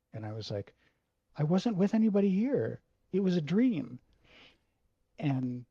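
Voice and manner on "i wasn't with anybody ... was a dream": raised, higher, louder denial; desperate yell. Retold or imitated. Teenage son yelling his denial at his furious dad. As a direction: shout back the denial in panic.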